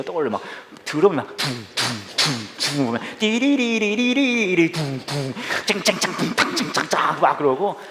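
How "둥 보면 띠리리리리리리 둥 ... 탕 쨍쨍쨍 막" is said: band noises. Retold or imitated. Imitating drums and band.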